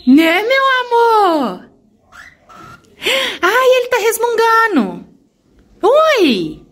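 squeaky high pet talk. Real, not acted. Cooing to the baby birds.

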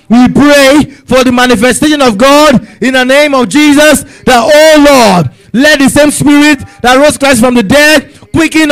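rising, urgent prayer, pressing each line; fervent prayer. Acted. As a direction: pray it out with full force to God. Fervent prayer leading congregation.

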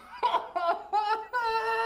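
a burst of high laughter. Real, not acted. Reacts to 125 hours.

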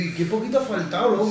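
ordinary delivery

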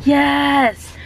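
high, triumphant cheer; excited. Real, not acted. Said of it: "so exciting to me".

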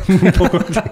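high-pitched, laughing repeat; amused echo. Real, not acted. Repite entre risas la respuesta.

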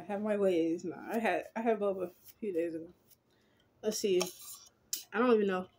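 lips sealed on straw; sipping while speaking; talking around straw. Real, not acted. Seen 0: lips on boba can straw throughout, removed by 4.5.

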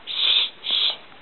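put-on whispered shh like a mommy hushing a baby; breathy shushing whisper. Grandma shows the mommy on the bus, whispering shh. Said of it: "Mommy's on the bus go".

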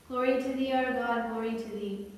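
usual speaking voice

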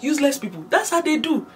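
high-pitched mock outrage; acted indignant rant. Man railing about the airport power cut, acted indignant rant. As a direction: rant like a disgusted fellow traveller.

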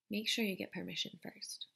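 soft whispered reminder. Acted. Whispered aside to reader.

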